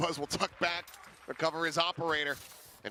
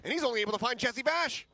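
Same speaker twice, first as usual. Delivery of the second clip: esports caster; 9 semitones higher, 4 dB louder than usual. Real, not acted. Hyped play-by-play call.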